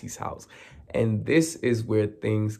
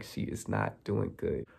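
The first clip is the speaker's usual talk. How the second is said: softer, lower murmured remark; hushed aside; 5 semitones lower, 11 dB quieter than usual. Retold or imitated. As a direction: say it under your breath. Mom quietly remarks to Lexi.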